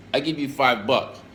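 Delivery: normal talking voice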